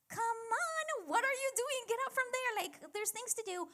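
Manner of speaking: high, loud nagging sister; bossy sister Martha. Retold or imitated. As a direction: scold her sister to get up and help. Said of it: "Martha, was like".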